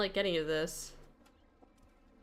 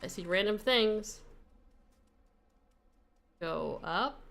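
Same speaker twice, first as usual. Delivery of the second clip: usual speaking voice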